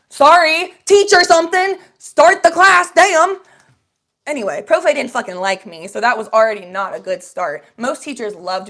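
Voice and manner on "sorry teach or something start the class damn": high and loud, mocking the teacher; sassy loud retort. Talking back to the Spanish teacher, loud sass. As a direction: fire it back with attitude.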